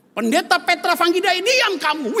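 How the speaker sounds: put-on high, loud shout of a scolding child; child's shout. Eldest child yells at arguing father, loud high shout.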